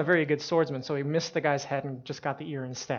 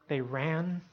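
ordinary delivery

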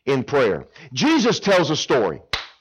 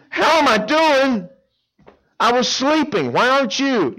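sarcastic, rudely woken; irritated neighbor; 11 semitones higher, 3 dB louder than usual. Acted. Woken neighbor retorting to the late caller, high and indignant.

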